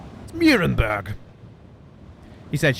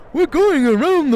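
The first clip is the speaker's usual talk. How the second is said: much higher and louder, bursting with eagerness; overly enthusiastic; 12 semitones higher, 9 dB louder than usual. Acted. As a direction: blurt it out far too keenly. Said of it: "with perhaps more enthusiasm than was warranted".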